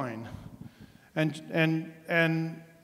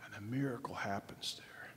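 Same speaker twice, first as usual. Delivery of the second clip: much softer, whispered; sudden whisper; 5 semitones lower, 12 dB quieter than usual. Real, not acted. Preacher telling the congregation of the miracle, hushed whisper. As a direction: drop to a hush right after the shout. Hushed after dramatic shout.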